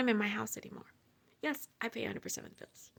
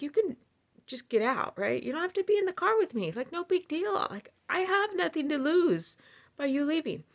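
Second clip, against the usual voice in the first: higher-pitched, retold arguing back; mildly heated retort; 6 semitones higher than usual. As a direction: argue back, voice raised in pitch. Retells her side of car argument.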